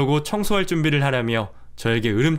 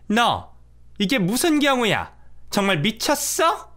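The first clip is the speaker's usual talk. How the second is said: higher scolding mother-in-law, slow on the last words; angry mother-in-law; 9 semitones higher, slower than usual. Acted. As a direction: tear into the daughter-in-law. Mother-in-law yells after the cleaning. (화가 나 꾸짖는 시어머니)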